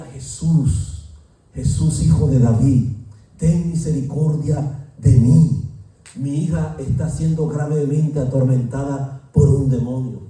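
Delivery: loud, desperate cry for mercy; shouted plea of a desperate mother; pleading shout. Retold or imitated. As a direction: cry out to Jesus, begging at full voice. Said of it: "Pero ella gritaba".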